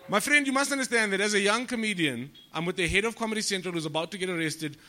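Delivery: normal talking voice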